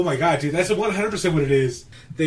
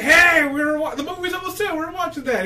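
imitated heckler, pitched way up; protesting bar patron; 12 semitones higher than usual. Bar patron objects to the channel change, high protest. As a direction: call out high in mock complaint. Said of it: "the guy in the background is like".

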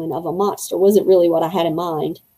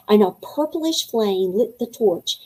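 ordinary delivery, nothing marked

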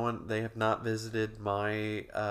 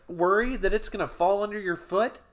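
high, loud sponsor announcer; mock ad read; 9 semitones higher, 4 dB louder than usual. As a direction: sell it like a spoof commercial.

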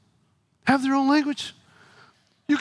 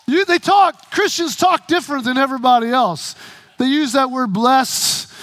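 normal talking voice

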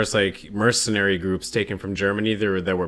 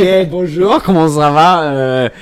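a hint of an English-accented French, louder; halting French; 2 semitones higher, 6 dB louder, slower than usual. Host greeting Quebec fans in halting French, louder. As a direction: stumble through a French greeting. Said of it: "our French accents".

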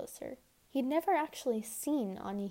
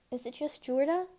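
higher, rising hopeful question; 4 semitones higher, 3 dB louder than usual. A narrator's reading. Anya asks if the powder is just Jurda, hopefully. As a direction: lift the ending with hope. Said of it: "she asked hopefully".

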